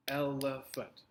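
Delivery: "Elephant" broken into three separate beats; syllable by syllable. Shown as a demonstration. Teacher counts out syllables for the class, "Elephant" split in three. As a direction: say "Elephant" slowly as el-e-phant, each part apart. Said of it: "three syllables, right".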